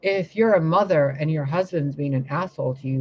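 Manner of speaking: usual speaking voice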